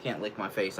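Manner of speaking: normal talking voice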